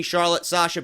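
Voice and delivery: usual speaking voice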